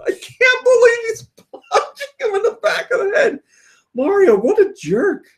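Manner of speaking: high-pitched appalled disbelief; scandalized, voice leaping way up; mock outrage. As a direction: squeal it in mock horror. Mock outrage at Mario's punch.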